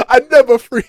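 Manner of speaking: loud, high mock African accent.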